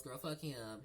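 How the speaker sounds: ordinary talk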